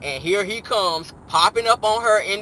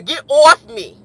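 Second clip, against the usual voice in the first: loud, higher New York holler; 6 semitones higher, 10 dB louder, slower than usual. Acted. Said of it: "ain't that how [name] talk".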